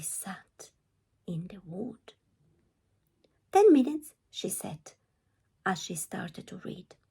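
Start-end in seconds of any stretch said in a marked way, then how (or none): 3.5-4.1 put-on higher and louder girl voice; Red's bright reply. Red telling the wolf she'll read, high and loud.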